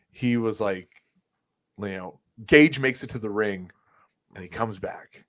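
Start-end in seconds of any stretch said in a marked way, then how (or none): none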